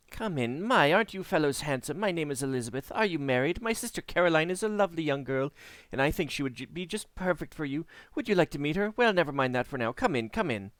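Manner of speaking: a hint of a breathless talker; a touch of a matchmaking hostess; chatty woman. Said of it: "talk without ever taking a breath".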